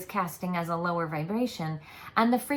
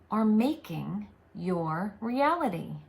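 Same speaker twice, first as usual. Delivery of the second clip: usual speaking voice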